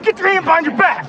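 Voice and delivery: high commanding shout; shouted surrender demands. Real, not acted. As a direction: order the suspect down hard. Felony stop at gunpoint.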